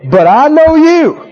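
acted fervent prayer, rising in pitch; crying out to God. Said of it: "put your nose in the carpet and say".

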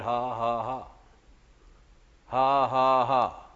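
forced, evenly spaced "ha, ha, ha"; deliberate laugh. Retold or imitated. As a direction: imitate laughing on purpose. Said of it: "he just began to laugh".

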